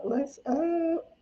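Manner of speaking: high, squeaky playful greeting. Pretend. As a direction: pitch it way up for fun. Squeaky greeting to Facebook viewers.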